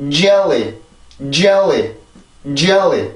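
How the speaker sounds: JELLY with precise English tongue position; English pronunciation. Shown as a demonstration. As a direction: drill JELLY the English way, falling each time. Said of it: "정확한 혀 위치를 잡고 발음".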